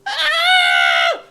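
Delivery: very high, very loud shriek; little elf screaming at the guard, very high and loud. Acted. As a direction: scream like a terrified child. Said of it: "little Elficus goes".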